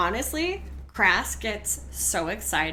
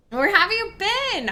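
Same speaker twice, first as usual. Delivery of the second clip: higher, eager question; excited; 8 semitones higher, 4 dB louder than usual. Real, not acted. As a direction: ask it again, delighted. Repeated with more excitement.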